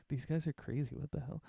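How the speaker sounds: a quiet, baffled grumble to himself; quiet aside. Acted. Cadriel mutters about the odd group, softly. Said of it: "He's like".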